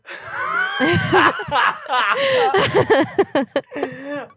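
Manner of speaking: prankster bursts out laughing after the call ends. Real, not acted.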